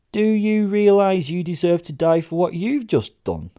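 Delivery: high, loud, outraged demand; loud indignant accusation over a ruined jacket; indignant outrage. Acted. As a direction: throw the accusation back at her. Narrator demands, pointing at jacket.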